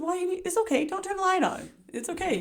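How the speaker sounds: high, urgent pleading; wife pleading with her sleepy husband, pitched high; pleading protest. Retold or imitated. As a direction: beg him to leave the light off. Pleading husband not turn light.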